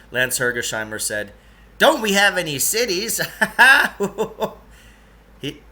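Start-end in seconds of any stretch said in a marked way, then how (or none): 1.8-3.2 put-on lightly raised, eager odd old fellow; a hint of a fussy eccentric guide; Lance's voice. Lance hurrying the two boys along, lightly higher voice. 3.3-4.5 flat mechanical ho ho laugh; hollow ho ho. Acted. Lance fake-laughing at the boys' question, hollow ho ho. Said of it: "He just said the words, "Ho, ho".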